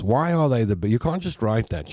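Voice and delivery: usual speaking voice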